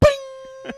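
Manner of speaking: high, loud cartoon bing; a bright comic impact noise; sound effect. Ice cube hitting her head, loud cartoon bing.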